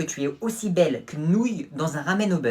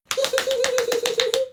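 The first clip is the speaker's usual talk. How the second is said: very high villain cackle; high evil cackle. Acted. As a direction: cackle up in the head. Ninja boasting.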